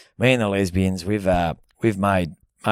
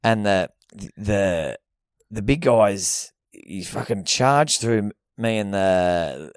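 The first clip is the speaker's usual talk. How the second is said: ordinary talk, nothing marked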